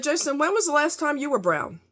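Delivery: quoting her own pointed question to her coworker; retold comeback. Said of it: "And I said".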